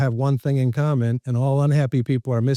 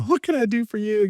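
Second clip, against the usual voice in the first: mock eager offer; 8 semitones higher than usual. Voices an over-willing helper.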